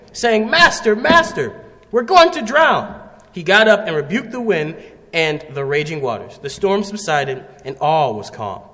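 loud, declaimed Bible story; dramatic scripture reading. A narrator's reading. Preacher reading the Gospel storm story to the congregation, loud and dramatic. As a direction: read it out boldly to the whole congregation. Reads the scripture passage aloud.

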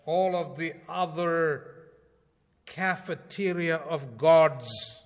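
urgent sermon delivery; fervent preaching. Acted. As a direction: preach it out to the congregation. Preaching at full force.

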